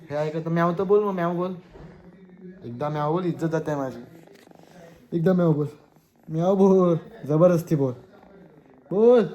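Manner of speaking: high, shouted pleading with a pet; loud insistence. Real, not acted. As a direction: keep pushing, building to a shout. Pleading loudly with pet to meow. (ओरडून हट्टाने आग्रह)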